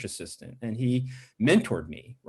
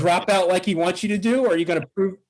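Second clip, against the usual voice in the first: higher, louder pep-talk voice, pushing him; retold challenge; 6 semitones higher, 4 dB louder, faster than usual. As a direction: fire him up, a coach daring him to fight back.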